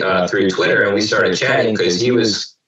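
ordinary talk

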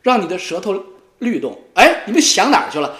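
usual speaking voice

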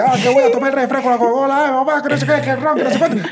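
high-pitched mock rambling; imitated chatterbox. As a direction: rattle on like someone who won't stop talking. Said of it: "este bicho tiene hablándome así todo el rato".

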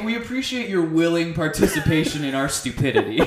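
tongue-in-cheek thanks through laughter; laughing. Real, not acted. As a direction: crack up as you thank them. Joking thanks while cracking up.

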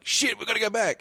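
high hurried cop voice; mock panicked cop. Police realise decoy, mock panicked high voice. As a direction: voice a flustered policeman. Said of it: "they're like".

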